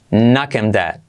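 normal talking voice

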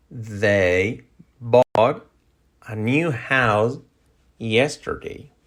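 slow, in separated chunks; slow model sentence. Shown as a demonstration. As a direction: pause between phrases for learners. Sentence broken up slowly for learners.

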